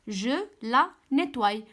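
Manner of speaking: slow, deliberate answer; slow read-aloud. Shown as a demonstration. Teacher giving pupils the answer, slow and deliberate. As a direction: lay out the answer slowly. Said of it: "teacher gives answer".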